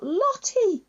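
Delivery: high, sobbing cry of a name; bawling. Acted. Someone in the car crying for the lost dog, high sobbing wail. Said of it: "someone bawling their eyes out".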